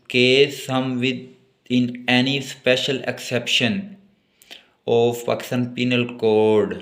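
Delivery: reads the statute slowly, word by word; slow dictation. Acted. Teacher slowly dictating law text to note-taking students. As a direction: dictate it so students can write.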